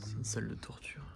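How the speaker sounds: hushed spooky whisper. Real, not acted. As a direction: breathe the words, unvoiced. (chuchoté, ton lugubre)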